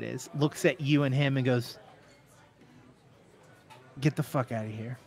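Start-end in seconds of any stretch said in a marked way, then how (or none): 4.0-5.0 low-key bouncer, softer voice; quietly curt doorman; dismissive bouncer. Acted. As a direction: turn them away without raising your voice. Game master plays dismissive bouncer.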